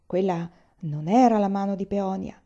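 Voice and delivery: plain reading voice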